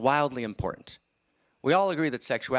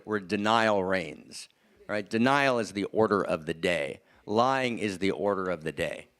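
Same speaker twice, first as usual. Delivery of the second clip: slow emphasis; 3 semitones lower, slower than usual. Real, not acted. Stressing key phrases.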